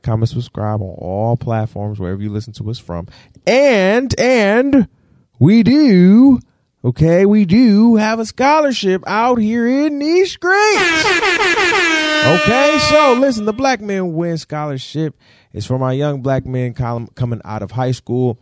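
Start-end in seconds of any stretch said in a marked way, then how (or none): 3.5-15.2 hyped-up announcement. Real, not acted.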